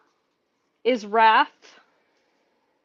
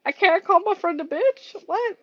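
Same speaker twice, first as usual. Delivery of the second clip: higher, incredulous questioning; mock disbelief; 6 semitones higher than usual. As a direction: act baffled, pitch climbing to the end. Acting out own disbelief at block.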